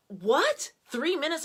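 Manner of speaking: high, incredulous "What? Three minutes?"; shocked disbelief. Retold or imitated. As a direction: recoil in mock shock at the idea. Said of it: "I'm like".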